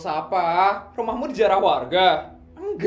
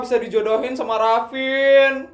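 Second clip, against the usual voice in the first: ordinary talk, nothing marked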